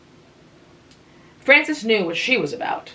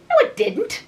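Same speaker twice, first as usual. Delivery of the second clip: higher, sharp scold. A narrator's reading. Anne scolding Tom for his broad talk, sharp. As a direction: snap back to tell him off. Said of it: "Anne scolded sharply".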